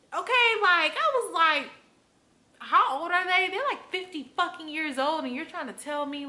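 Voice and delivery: loud, high, incredulous outburst; outraged. Real, not acted. Venting about older coworkers, loud and incredulous. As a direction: blast it out in disbelief.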